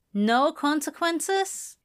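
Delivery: high, slow, rising question; questioning echo. Acted. Girl echoes it as a question.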